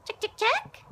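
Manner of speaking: high singsong chicken call. Real, not acted. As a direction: call the hens over. Calling chickens.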